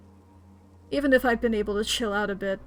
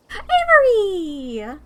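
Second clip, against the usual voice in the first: long, loud call, much higher; 9 semitones higher, 8 dB louder, much slower than usual. Acted. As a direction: call the name across the path, drawn out. Character calls out to player.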